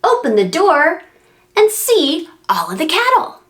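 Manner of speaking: lilting, sing-song picture-book voice; reading to a child. Acted. Parent reading a barn book to a child, sing-song. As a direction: read it like a bedtime rhyme for a little one.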